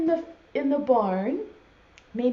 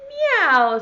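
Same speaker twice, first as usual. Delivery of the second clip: high, loud drawn meow; cat's meow; 6 semitones higher, 6 dB louder than usual. Acted. Teacher voicing the cat in a farm poem, meowing. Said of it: "said the cat".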